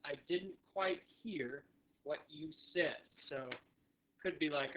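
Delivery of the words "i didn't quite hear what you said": deliberate repeat-along delivery; all-together model. Shown as a demonstration. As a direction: lead learners through it word by word. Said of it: "now all together".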